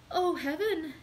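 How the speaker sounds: put-on impassioned young woman's outcry; fervent exclamation. A narrator's reading. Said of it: "exclaimed Julie".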